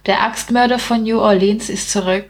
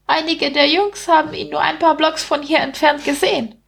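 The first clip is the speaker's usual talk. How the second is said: high-pitched little rat telling grim news; gossiping rat; 8 semitones higher than usual. Acted. Rat warning the big man of a killer, high voice.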